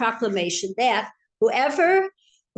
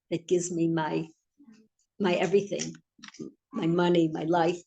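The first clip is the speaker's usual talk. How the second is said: humble sincere reply; 3 semitones lower than usual. Retold or imitated. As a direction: answer the angry boss meekly and sincerely. Humble employee answers upset boss.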